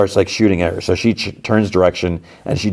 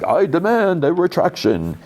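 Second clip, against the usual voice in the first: indignant actor voice; 11 semitones higher than usual. Retold or imitated. Clayface barges in indignant.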